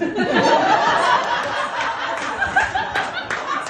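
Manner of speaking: long airy high laugh; breathy high laugh. Real, not acted. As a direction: laugh it off in a wheeze. Laughs at own dilemma.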